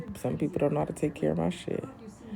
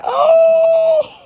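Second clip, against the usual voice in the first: high loud wailing Oh; overcome cry; 13 dB louder than usual. Real, not acted. Mom watching her son keep playing, crying out. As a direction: squeal out a tearful oh.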